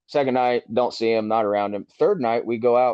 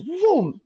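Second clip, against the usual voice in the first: drawn-out, high-pitched whoosh of impact; sudden vocal crash effect; imitated crash boom. Driver retelling the sudden crash, imitated boom.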